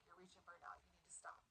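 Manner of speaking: whispered, urgent warning to stop; a soft retold whisper; hushed warning. Friend warns her to stop.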